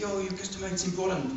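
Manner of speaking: imitating an Indian shopkeeper's accent, pitched high; the fruit seller's Indian accent done quickly; Indian accent imitation. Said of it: "doing an Indian accent".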